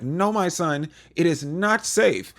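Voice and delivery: high, slow mock motherly plea; hammed-up worried mother; mock worried mom. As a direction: play the overprotective mom, drawn out. Joyce protecting her son.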